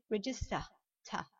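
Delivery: "register" ending in a British -ta; British English. Shown as a demonstration. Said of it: "si vous le dites en British English". (« register » à la britannique, r final muet)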